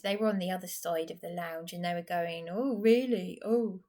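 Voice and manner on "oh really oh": imitating the men's mocking "Oh, really?". A vlogger mimics the men mocking her, mocking fake surprise. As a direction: mimic their sneering fake interest. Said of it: "making comments".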